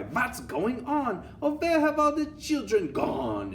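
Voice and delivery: hammed-up high-pitched lament; teachers' cry. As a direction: wail like bewildered teachers. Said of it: "The teachers cry".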